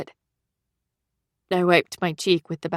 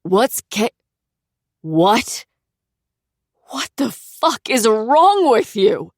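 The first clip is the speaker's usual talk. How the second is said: loud, higher, slow furious outburst; trying to be furious; 5 semitones higher, 6 dB louder, slower than usual. Acted. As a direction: rage through tears. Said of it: "he wanted to be furious".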